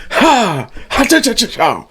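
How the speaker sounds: high, hammed-up Ha cha cha cha; mock old-timey showman laugh; vaudeville bark. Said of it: "he goes".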